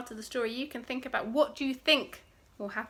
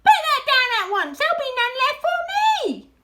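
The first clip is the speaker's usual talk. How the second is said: high, loud, rapid scolding; angry shriek; 13 semitones higher, 11 dB louder, faster than usual. A narrator's reading. Rat guarding cider from the others, shrieking. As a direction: shriek the order in a rush. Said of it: "shrieked Rat".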